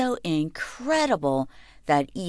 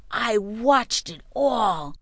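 excited; 3 semitones higher, slower than usual. A narrator's reading. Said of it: "he said excitedly".

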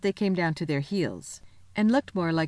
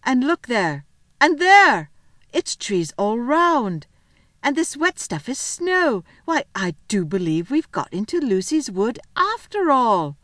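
higher, louder, stretched key words; excited realisation; 6 semitones higher, 5 dB louder, slower than usual. Acted. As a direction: build wonder with each new discovery. Peter realises they are in Narnia.

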